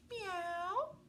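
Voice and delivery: very high, drawn-out meow; cat meow. A narrator's reading. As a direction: meow like a cat, rising at the end. Imitating cat in read-aloud story.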